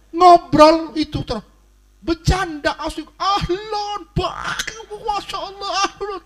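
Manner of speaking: very high, strained mock shouting; bercanda kayak ribut. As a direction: act out rowdy joking that sounds like a brawl. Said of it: "bercandanya kayak ribut". (teriakan bercanda riuh bernada tinggi)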